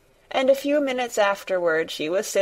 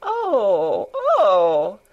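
drawn-out gasps of delight, the second louder; delighted cry; 5 dB louder than usual. A narrator's reading. Boy hearing the creatures will visit, crying out in delight. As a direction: cry out with falling, delighted ohs. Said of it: "cried out in delight".

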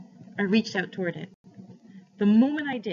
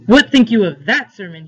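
much louder, demanding question; loud challenge; 16 dB louder than usual. A narrator's reading. Man asking the boy about his sermon, loud challenge. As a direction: throw the question out loudly.

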